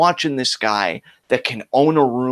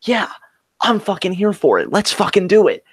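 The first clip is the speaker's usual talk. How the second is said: higher, eager hype building to the end; rally yourself, all in; fired-up anticipation; 6 semitones higher than usual. Retold or imitated. Acting out past hyped anticipation.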